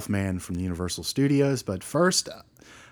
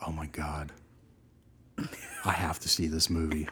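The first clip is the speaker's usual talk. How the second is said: breathy disbelieving whisper; whispered amazement; 5 semitones lower, 7 dB quieter than usual. Real, not acted. Laughing at the silliness.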